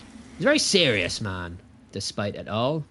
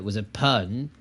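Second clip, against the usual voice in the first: ordinary talk, nothing marked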